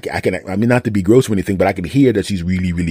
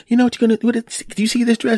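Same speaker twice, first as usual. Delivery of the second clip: high-pitched mock wife voice; imitated wife. Voices wife showing her dress.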